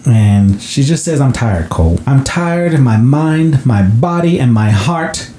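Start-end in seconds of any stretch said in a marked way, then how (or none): 1.2-5.3 lightly voiced weary mom in a New England accent; a hint of a New England mom pleading, rising high. Mother tells Cole she needs help, mild New England accent. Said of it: "New England fucking accent".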